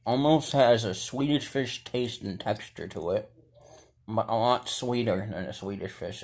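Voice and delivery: speaking between bites; chewing while talking. Real, not acted. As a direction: mouth partly full of candy. Seen 2: Gloved hand bites red gummy at mouth; mouth works while talking.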